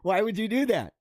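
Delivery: high, disbelieving outburst; incredulous. Retold or imitated. Friend reacting to the ear in shocked disbelief. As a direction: act shocked at a botched surgery. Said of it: "You're like".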